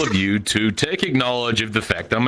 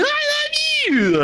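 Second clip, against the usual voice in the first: a much higher, slow, syrupy "love" as he mocks the couple; mock lovey-dovey vow; much slower than usual. Mocking the couple at the altar, a high, drawn-out vow. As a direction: sneer at their vows by singing them up high.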